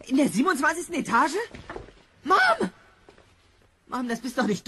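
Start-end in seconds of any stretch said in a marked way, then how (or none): 2.2-2.7 sudden high, loud cry for his mother; a panicked yell, pitched way up; alarmed shout. Acted. Son crying out at the woman posing as his mother, alarmed shout. Marty sees a stranger as mom.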